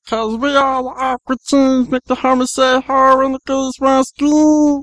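high cartoon voice, slowing at the end; high squeaky Meatwad rap. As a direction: keep the squeaky cartoon voice going. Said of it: "go on a little bit more".

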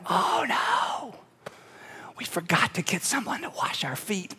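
very soft, sinking realization; hushed dismay. Acted. Disciples seeing Jesus with the towel, quietly dismayed. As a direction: murmur it as if caught out.

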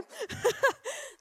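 high embarrassed giggle; awkward laugh. Real, not acted. Picture fails to appear.

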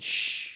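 long hushing "shhh"; imitated shushing sound; drawn-out shush. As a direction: shush someone about to speak. Retold hushing by Holy Spirit.

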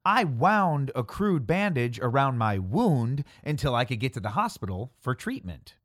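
usual speaking voice

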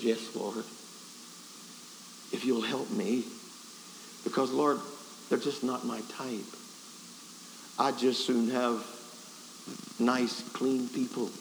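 low, halting confession; subdued prayer. Retold or imitated. Preacher admitting his reluctance to the Lord, low and halting. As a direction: answer low and reluctantly, with long pauses.

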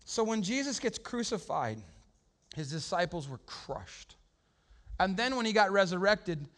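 ordinary talk

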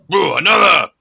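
high, loud tavern cheer; rowdy drinker toast. Retold or imitated. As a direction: slam the desk and demand another. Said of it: "you decided to be like".

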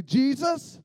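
short phrases with big pauses, punched loud; loud, swooping chant-like declaration; emphatic call-and-response litany. Shown as a demonstration. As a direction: preach it phrase by phrase for the crowd to repeat. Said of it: "I like to say it like this".

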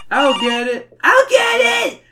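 high, loud little-brother holler, building to a squeal; escalating cartoon kid yell. A narrator's reading. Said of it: "Stinko hollers".

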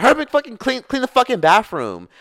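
high, loud yell imitating an angry sister; mock yelling. Sister ordering a younger sibling to clean, yelled. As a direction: screech the order, imitating her. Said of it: "Don't fucking yell at me".

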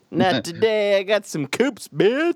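sassy housewife. Retold or imitated. Housewife showing off coupons to a cashier, mock sassy brag. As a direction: brag about the coupons, rising to a high sneer on bitch. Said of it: "My housewife just puts down the detergent".

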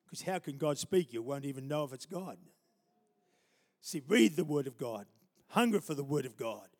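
ordinary delivery

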